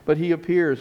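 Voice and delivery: ordinary talk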